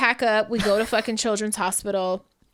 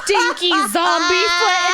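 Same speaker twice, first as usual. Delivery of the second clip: higher, louder retold jeer; loud child's taunt; 7 semitones higher, 6 dB louder than usual. Mother acts out her child heckling a boy's bare foot, loud taunt. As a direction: call it out like a tactless kid.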